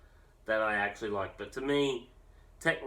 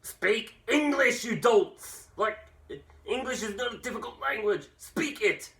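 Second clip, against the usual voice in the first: high, loud, outraged yelling; exasperated shout; 11 semitones higher, 4 dB louder than usual. Retold or imitated. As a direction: bellow it like a fed-up ranter. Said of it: "and it's like".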